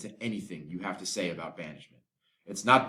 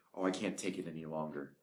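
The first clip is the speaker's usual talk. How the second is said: despairing; 5 semitones lower, 10 dB quieter than usual. Acted. Romeo in despair.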